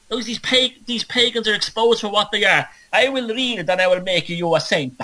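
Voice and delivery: a squeaky, hammed-up monk thanking the pope; fawning cleric. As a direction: pitch way up and gush like a grateful clerk. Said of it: "And then the guy goes".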